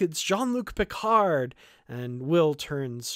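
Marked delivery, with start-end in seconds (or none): 0.0-1.5 high, louder excited call; retold yell. Daughter telling her father who has arrived, excited yell. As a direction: announce the visitor with a shout. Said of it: "the daughter yells back".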